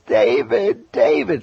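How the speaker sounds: high, loud excited calling of a name; wife yelling with news, high and loud. Retold or imitated. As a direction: yell his name in wild excitement. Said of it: "I heard her yell".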